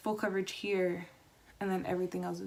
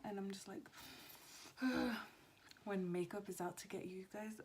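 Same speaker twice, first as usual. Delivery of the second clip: soft, hushed talk; quiet at night; 10 dB quieter than usual. Real, not acted. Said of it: "talking a lot lower than I usually do".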